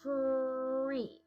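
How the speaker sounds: TREE pulled out slowly like a rubber band; stretched like rubber band. Shown as a demonstration. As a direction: stretch the r in TREE, then glide into ee. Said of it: "do it with the rubber band".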